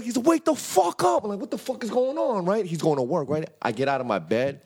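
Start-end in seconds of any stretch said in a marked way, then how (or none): 0.3-1.2 very high-pitched wake-up cry; mock panicked yell; acted urgent yell. Brother urgently waking him. 1.4-2.4 high-pitched confused outcry; mock alarmed question, pitched up; acted bewilderment. Acting out waking up confused.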